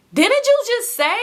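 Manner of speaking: high, drawn-out disbelieving question; incredulous. Retold or imitated. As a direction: squeal it slowly, as if you can't believe it. Incredulous retort in retold argument.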